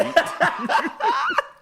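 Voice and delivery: shrieking laugh; high-pitched cackle; high squealing laugh. Real, not acted. Cracks up at tweet.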